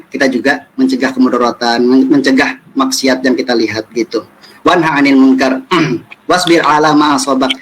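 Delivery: usual speaking voice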